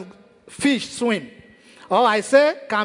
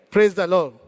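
ordinary talk, nothing marked

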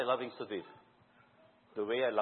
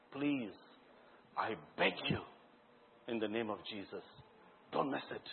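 pleading; 5 semitones lower, 10 dB quieter than usual. Real, not acted. Earnest plea to congregation.

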